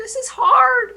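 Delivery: put-on complaining child whine, high and loud; whiny kid. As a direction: mimic a frustrated child. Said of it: "if your kids are like".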